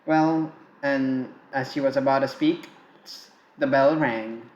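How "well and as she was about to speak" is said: plain reading voice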